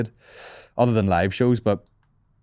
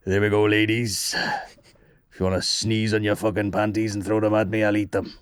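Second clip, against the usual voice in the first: mock sleazy singer voice. Put-on voice in a joke scenario.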